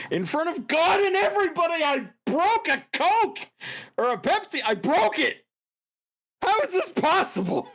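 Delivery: high, loud exasperated rant; loud disbelief. Real, not acted. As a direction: rant in amazed outrage. Loud disbelief at broken can.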